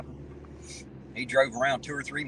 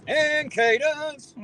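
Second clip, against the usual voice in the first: drawn out, high, loud drill sergeant call; 13 semitones higher, 5 dB louder, much slower than usual. Acted. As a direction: bark it slowly like a drill instructor. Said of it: "Sound like a fucking drill sergeant now".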